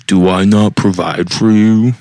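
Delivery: mock passive-aggressive husband. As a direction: put on a husband's pointed, wounded tone. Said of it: "passive-aggressively".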